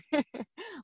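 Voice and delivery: a quiet, high little laugh; soft laugh. Real, not acted. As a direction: let a breathy giggle slip out. Reacting to co-host.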